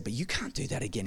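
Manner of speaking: whisper. Retold or imitated. Father calmly correcting son, soft whisper. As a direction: keep it hushed but firm. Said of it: "I whispered into his ear".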